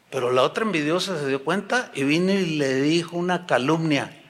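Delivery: ordinary talk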